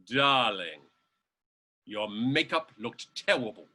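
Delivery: low, sultry-toned diva drawing out darling; a hint of a grand German diva passing judgment; Marlena Dietrich voice. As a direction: Dietrich scolding her mother on the phone, lightly voiced. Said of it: "she hears that voice say".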